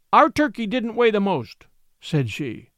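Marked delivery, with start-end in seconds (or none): 0.1-1.7 put-on higher, clearly spoken child's confession. A narrator's reading. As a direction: speak out clearly, pitch lifted. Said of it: "she spoke out quite clearly".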